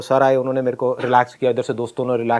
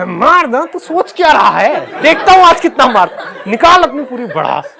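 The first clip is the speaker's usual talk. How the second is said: high, much louder shouted taunts, laughing through them; loud taunting; 10 semitones higher, 6 dB louder, slower than usual. Retold or imitated. Schoolboy taunting the trembling PT sir, shouting and laughing. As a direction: yell the dares, mocking the teacher. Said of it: "बहुत तेज मैं बोल रहा था".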